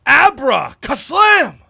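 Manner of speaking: high, loud, drawn-out incantation; triumphant shout. Acted. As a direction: shout it slowly like a magic spell. Defiantly shouts the magic words.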